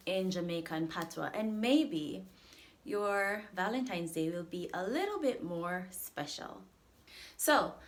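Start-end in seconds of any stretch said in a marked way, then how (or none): none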